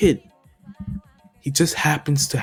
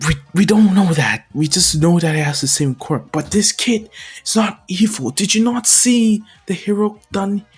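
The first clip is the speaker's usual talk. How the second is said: raised, higher, insistent voice; impassioned defence; 7 semitones higher, 3 dB louder than usual. Acted. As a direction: argue heatedly for an accused kid. Hero argues to defend boy.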